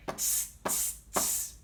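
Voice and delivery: three sharp "tss" hisses; unvoiced hissing monkey chatter. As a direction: mimic cheeky monkeys going tss. Imitates the monkeys' noise.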